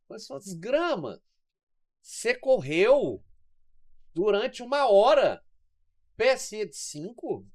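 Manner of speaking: slow, punched outrage at the number five; emphatic disbelief. Real, not acted. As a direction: spell out how absurd that rating is. Indignant about runner's low rating.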